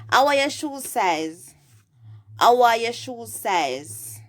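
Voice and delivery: Jamaican Patois. Shown as a demonstration. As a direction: ask it in Patois, projected for learners. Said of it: "translate this into patois".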